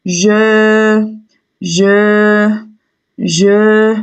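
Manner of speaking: "Je" three times, each held level; drawn-out syllable. Shown as a demonstration. Teacher repeating the answer for pupils, "Je" held long each time. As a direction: stretch each "Je" evenly, pausing between. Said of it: "la deuxième syllabe".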